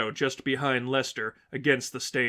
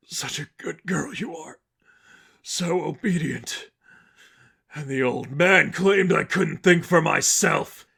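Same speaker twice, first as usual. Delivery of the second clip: whispered, then slow and louder mocking voice; sinister taunt; 4 semitones higher, 3 dB louder than usual. A sinister voice from the past taunting Angel, whisper growing louder.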